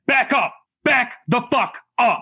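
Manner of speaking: slow, forceful retold threat; retold warning. Parent retelling warning off a creepy stranger, slow and forceful. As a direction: order them back, each word weighted.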